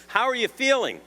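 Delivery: mock concern. Judge's pointed greeting after the lie.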